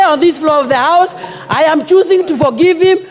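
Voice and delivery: usual speaking voice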